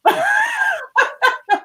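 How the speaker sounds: a burst of high laughter. Real, not acted. Laughing after the story.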